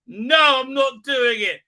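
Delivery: loud, high-pitched mock scolding; firm refusal. Owner refusing the cat milk, loud and firm. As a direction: put your foot down with a pet.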